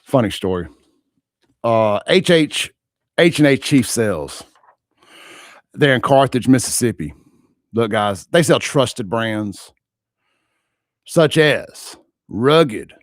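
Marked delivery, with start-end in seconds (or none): 1.6-12.9 loud, slow announcer boom; rodeo announcer voice. Shown as a demonstration. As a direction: boom it out from the belly. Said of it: "rodeo announcer voice".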